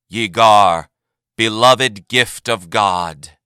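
slow, loud solemn repeat; echoed proclamation. A narrator's reading. The name is repeated to the assembly, slow and solemn. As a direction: repeat it like a chant.

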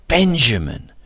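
loud, high cry of Benjamin; greeting an old friend, crying out Benjamin; joyful cry. A narrator's reading. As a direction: call out Benjamin in glad shock. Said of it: "cried Dax".

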